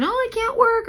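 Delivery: high whiny mock complaint; mocking a whiny claimant. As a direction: whine like a fake injured claimant.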